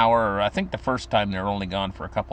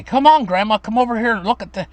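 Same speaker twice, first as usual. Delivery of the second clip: put-on eager little boy; 11 semitones higher, 7 dB louder than usual. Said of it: "And I'd say".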